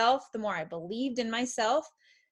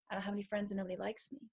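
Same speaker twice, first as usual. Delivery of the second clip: softer, fast, deflated rattle-off; quick defeated mumble; 11 dB quieter, much faster than usual. Retold or imitated. Mother voicing a child's defeated belief, soft and fast. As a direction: rush it out like a defeated sigh. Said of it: "our reality becomes".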